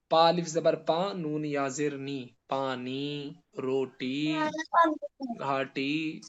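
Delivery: slow spelling aloud, پانی ending in a long "ee"; maroof long ee. Shown as a demonstration. Teacher spells out examples for students, پانی with a drawn-out "ee". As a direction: stretch the final "ee" of روٹی and گھاٹی. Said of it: "معروف پڑھیں تو معروف پتہ چلے".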